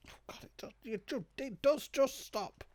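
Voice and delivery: ordinary talk, nothing marked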